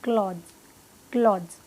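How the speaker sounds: क्लॉथ्स as "klodz", no th; one falling syllable, क्लॉथ्स with a buzzed dz ending; correct clothes pronunciation. Shown as a demonstration. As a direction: close क्लॉथ्स on D plus Z. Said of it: "द और ज का sound आएगा पीछे".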